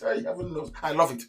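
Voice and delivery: ordinary talk, nothing marked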